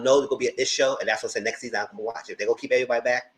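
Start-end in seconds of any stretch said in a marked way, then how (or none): none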